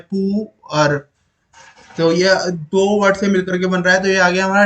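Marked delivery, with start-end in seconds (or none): 0.0-1.0 "पू, अर" as two separate sounds; teacher breaking the word into "पू", then "अर"; split in two. Shown as a demonstration. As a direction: pause between "पू" and "अर". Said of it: "अलग अलग word से मिलकर".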